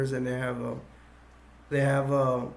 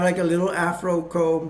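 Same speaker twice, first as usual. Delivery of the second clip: ordinary talk, nothing marked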